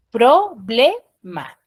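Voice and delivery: slow, split into syllables: "Pro-ble-ma"; syllabified slowly. Shown as a demonstration. Teacher showing the class how to syllabify, slow and split. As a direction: spell "Problema" out syllable by syllable, very slowly. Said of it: "yo empiezo a silabiar".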